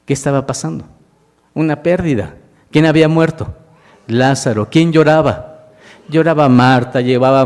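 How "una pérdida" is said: ordinary delivery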